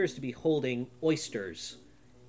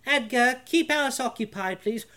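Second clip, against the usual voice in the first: high, bright put-on lady voice; Isabel's voice; 11 semitones higher, 6 dB louder than usual. Isabel covers and sends Edgar off, high lady voice. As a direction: play the young lady covering smoothly. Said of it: "She goes".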